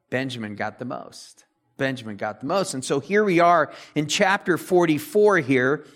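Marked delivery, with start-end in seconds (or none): 0.1-1.4 soft aside. Shown as a demonstration. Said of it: "repeated for emphasis".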